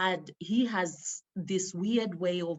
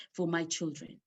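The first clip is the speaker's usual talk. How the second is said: normal talking voice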